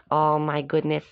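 ordinary delivery

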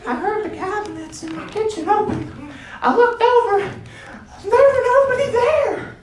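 put-on old woman telling her ghost story, high voice; old lady voice. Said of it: "and she's like".

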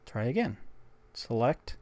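normal talking voice

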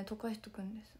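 hoarse whisper. Real, not acted. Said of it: "声ガサガサすぎる".

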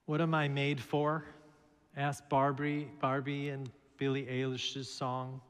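ordinary talk

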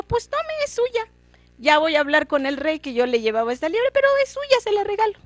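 put-on high, teasing boy's voice; cheeky boy. The boy hands the hare to the disguised king, high and teasing. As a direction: offer it with mock generosity.

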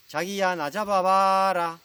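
loud, drawn-out playful call with aegyo; coquettish Seoul bride. Acted. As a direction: call teasingly to be chased, stretching the last word long. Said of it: "애교 있는 몸짓으로 막 뛰어가며". (애교 부리며 길게 늘여 부르는 신부)

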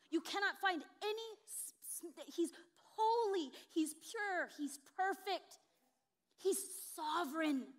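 building pulpit exclamation; rising proclamation. Real, not acted. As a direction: proclaim it like a shout of praise. Preacher proclaiming God's attributes.

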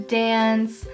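ordinary delivery

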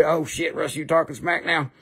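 ordinary talk, nothing marked

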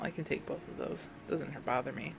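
muttered aside. Real, not acted. Muttered aside about pills.

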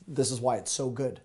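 ordinary talk

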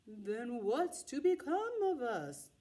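very slow, drawn-out haunting voice; slow eerie voice of the haunted clothes; spooky creature voice. Acted. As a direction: stretch every word like a ghost. Pumpkin head asks sadly.

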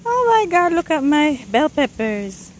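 high, loud "Oh my God" of dismay; shocked dismay. Real, not acted. Gardener discovering her dried-up bell peppers, high shocked exclamation. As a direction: gasp it out at the ruined plants.

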